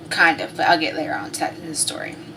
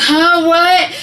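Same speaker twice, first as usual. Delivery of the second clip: loud, higher groggy-startled imitation; startled awake; 10 semitones higher, 7 dB louder than usual. Husband jolted awake by his wife, startled. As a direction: blurt it as if jolted from sleep.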